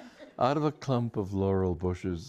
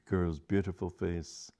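ordinary delivery, nothing marked

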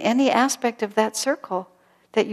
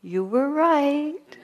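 slow, higher, blissful; a dying woman blissfully reassuring her friend; 8 semitones higher, much slower than usual. Retold or imitated. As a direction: let it glow with peaceful relief. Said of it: "just blissfully saying".

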